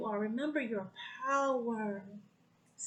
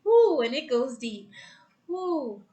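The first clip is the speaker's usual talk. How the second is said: joyful whoop; 2 semitones higher, 11 dB louder than usual. Real, not acted. Emotional whoop on live call.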